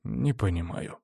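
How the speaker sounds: soft, puzzled remark; frowning puzzlement. A narrator's reading. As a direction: say it quietly with a frown. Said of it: "нахмурился Артур".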